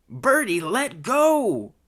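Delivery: high, loud urgent command; shouted order. Acted. Thug ordering accomplice off, shouting urgently. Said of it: "shouted her fellow thug".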